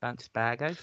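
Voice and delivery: soft, rising Australian accent attempt. Shown as a demonstration. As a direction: quietly try out an Australian accent. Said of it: "sounds really good in Australian accent".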